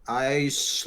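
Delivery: usual speaking voice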